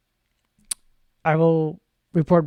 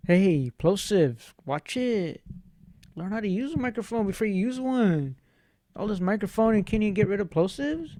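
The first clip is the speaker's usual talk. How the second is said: mock heckler; 4 semitones higher, 5 dB quieter than usual. Heckler scolding someone about plosives on the mic, mock scolding. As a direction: needle someone like a nitpicking critic.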